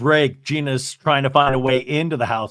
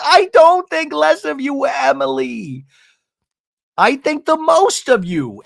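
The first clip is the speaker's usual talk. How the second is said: high, drawn-out mock pleading; hammed-up heartfelt protest, slow on the name; retold emotional reassurance; 10 semitones higher, 4 dB louder than usual. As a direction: imitate a friend gushing through a reconciliation. Acted tearful reconciliation.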